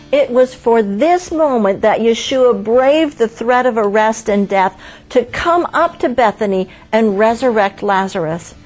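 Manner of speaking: usual speaking voice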